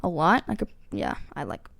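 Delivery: halting, stumbling over words; stuttering. Real, not acted. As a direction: stammer through it. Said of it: "I'm stuttering right now".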